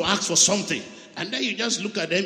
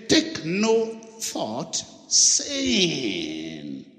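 very slow, deliberate reading of the verse; slow scripture quote; 5 semitones lower, much slower than usual. Acted. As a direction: let each word of scripture land. Quoting scripture slowly.

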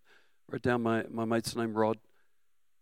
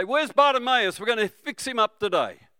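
high, loud cheery call; light hint of Jesus calling out; Jesus' cheerful call; 10 semitones higher, 6 dB louder than usual. Pretend Jesus seeking Bartimaeus, loud and cheery. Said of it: "He didn't stop and say".

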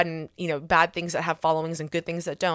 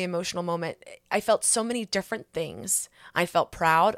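usual speaking voice

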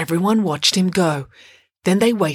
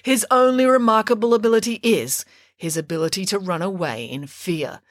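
usual speaking voice

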